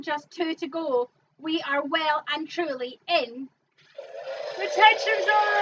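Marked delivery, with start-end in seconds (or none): 4.6-5.6 dramatic announcement. Acted. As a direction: announce it like a big reveal. Dramatic zone announcement with music.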